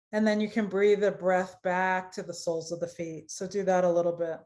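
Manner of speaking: measured phrases with long pauses; guided meditation. Acted. Yoga teacher leads students through root chakra, guided meditation voice. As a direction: guide awareness through the body, leaving space to feel.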